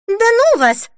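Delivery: high, loud exasperated wail; yelling. A narrator's reading. Denise fed up with her unhelpful friends, yelling. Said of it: "завопила Денис".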